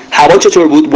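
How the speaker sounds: ordinary talk, nothing marked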